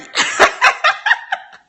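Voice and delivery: high, breathy laugh bursting out; burst of laughter. Real, not acted. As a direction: crack up at yourself. Laughs at her own hype.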